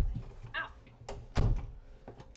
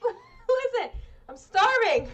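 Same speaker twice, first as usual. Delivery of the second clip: laughing loudly while talking; 4 semitones higher, 8 dB louder than usual. Real, not acted. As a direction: laugh through the words. Laughing about hunger.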